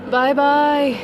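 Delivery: loud high call to the sky; shouting bye. Real, not acted. Waving bye to planes overhead.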